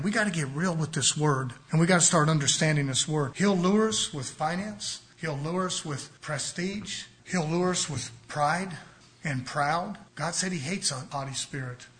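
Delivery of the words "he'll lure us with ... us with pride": slow, punch "lure" each time; stress on lure. Acted. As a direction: drive home "lure" slowly. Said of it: "repeated lure phrase".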